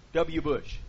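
usual speaking voice

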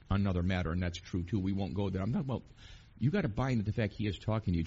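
ordinary talk, nothing marked

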